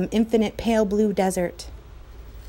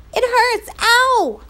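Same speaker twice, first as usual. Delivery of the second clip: much higher, louder yelp; yelling in pain; 13 semitones higher, 6 dB louder, slower than usual. Acted. Patrick still hurting from the shot, yelling. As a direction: cry out from the sting. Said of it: "Patrick kept yelling".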